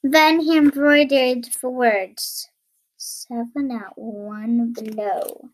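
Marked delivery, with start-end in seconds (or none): 3.0-5.5 soft, low and drawn out; slow solemn read-out. Acted. Tailor's words embroidered on the belt, slow solemn read-out. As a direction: spell out the motto slowly and quietly.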